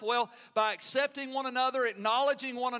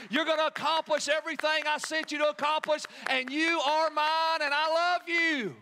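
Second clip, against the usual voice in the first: proud father cheering; 4 semitones higher than usual. Acted. God applauding his Son.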